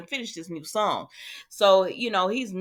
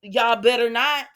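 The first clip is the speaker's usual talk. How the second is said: higher, mock-stern warning to the characters; playful warning; 5 semitones higher, 4 dB louder than usual. Real, not acted. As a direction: wag a finger at the screen. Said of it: "I said".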